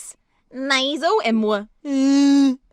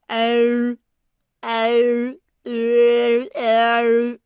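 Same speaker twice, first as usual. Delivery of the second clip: bright ringing oh; 4 dB louder, much slower than usual. Shown as a demonstration. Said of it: "this is what my fingers are reminding me to do".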